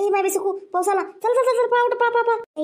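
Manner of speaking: high-pitched panicked warning; hurried, high cries to run; urgent alarm. Acted. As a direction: rush everyone out of the rain. Sudden rain, women run for shelter.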